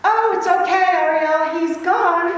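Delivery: loud, high call across the room; yelling. Retold or imitated. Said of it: "And she yells out".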